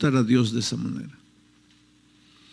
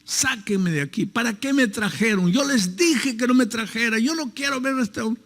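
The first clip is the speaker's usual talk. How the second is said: imitated yelling; 9 semitones higher than usual. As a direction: act out the young man yelling in protest. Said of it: "el muchacho comenzó a gritar".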